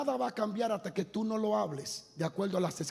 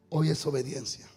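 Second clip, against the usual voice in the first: loud, measured preacher's declaration; emphatic preaching; 2 semitones lower, 8 dB louder than usual. Real, not acted. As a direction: proclaim each phrase with weight and pauses. Preaches contrasts to congregation. (predicación enfática y pausada)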